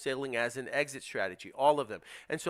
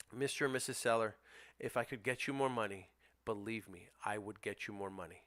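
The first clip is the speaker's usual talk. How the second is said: softer, calm buyer's pitch to the seller; calm, reassuring script; 6 semitones lower, 9 dB quieter than usual. Shown as a demonstration. Investor calmly telling the sellers he can't pay more. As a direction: reassure the seller gently, firm underneath. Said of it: "that's how you handle that objection".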